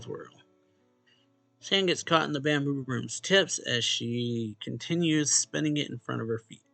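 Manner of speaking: ordinary talk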